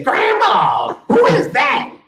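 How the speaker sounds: high, loud shocked yell; shocked shout. Retold or imitated. Retelling his reaction to his sister, high shocked shout. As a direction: blurt it out in disbelief.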